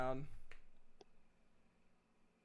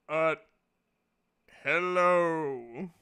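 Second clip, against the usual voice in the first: booming god hello; 9 dB louder, much slower than usual. Acted. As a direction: boom a wary hello. God answers greeting.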